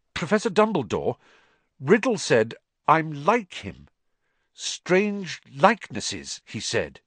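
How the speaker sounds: put-on an unsettled boy blurting a worry; boy's voice, lightly lifted; young Harry. A narrator's reading.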